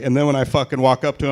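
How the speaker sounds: ordinary delivery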